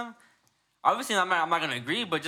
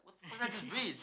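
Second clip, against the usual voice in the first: soft, higher-pitched mock disbelief; murmuring under breath; 5 semitones higher, 8 dB quieter, faster than usual. Scientologist reacting to the manual, softly muttered disbelief. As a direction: retell a muttered reaction, half laughing. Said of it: "like, murmuring under her lip".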